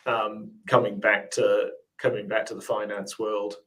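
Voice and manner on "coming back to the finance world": normal talking voice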